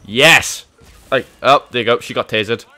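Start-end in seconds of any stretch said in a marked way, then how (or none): none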